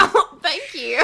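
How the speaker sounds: loud, slow thanks through real laughter; amused, laughing acknowledgement. Real, not acted. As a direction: crack up while saying thanks. Wife cracks up at praise.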